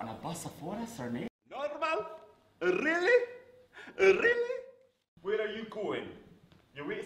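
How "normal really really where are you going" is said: rising, much higher, incredulous; each "Really?" climbs higher in shock; disbelief. Real, not acted. Astonished repeated questions.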